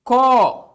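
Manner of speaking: "copo" with a long, loud CO; stress on CO. Shown as a demonstration. Teacher showing the tonic syllable, stretched first syllable of "copo". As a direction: hold the CO of "copo", then drop the po. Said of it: "Qual que é a sílaba tônica de copo?".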